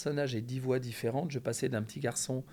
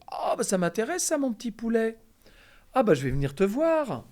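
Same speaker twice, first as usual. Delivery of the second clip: delighted, much higher and louder; excited high voice; 10 semitones higher, 8 dB louder than usual. Retold or imitated. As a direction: gush like an old showman. Roger Carrel voiced, delighted.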